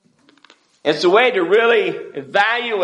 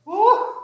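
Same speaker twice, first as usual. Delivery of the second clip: high laughing whoop; laughing woo. Real, not acted. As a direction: whoop with delight. Preacher laughs, exclaims.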